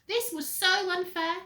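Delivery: high, loud outrage; indignant. A narrator's reading. Narrator voicing Henry's outrage, loud and high. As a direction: sound truly hard done by.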